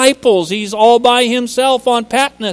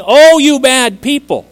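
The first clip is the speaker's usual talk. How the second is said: scolding; 4 semitones higher, 6 dB louder than usual. Pretend. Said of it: "But he's not saying".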